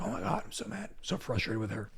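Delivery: grumbling to himself in a hushed voice; mock frustration. Husband imitating himself waking up mad at his wife, quiet mock grumble. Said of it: "I'm like".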